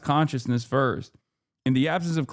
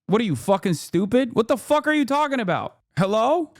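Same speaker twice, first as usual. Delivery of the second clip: exasperated outburst; 8 semitones higher than usual. Real, not acted. Exasperated at chat.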